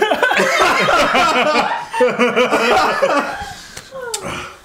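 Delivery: long high burst of laughter. Real, not acted. Big laugh at friend.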